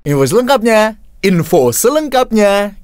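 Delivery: "selengkapnya" pushed high and drawn out. Shown as a demonstration. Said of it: "Artikulasi aja sini sama speed readingnya".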